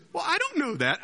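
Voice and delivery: high-pitched flustered answer; mock panic. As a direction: squeal it as the doomed knight. Doomed knight fails the question.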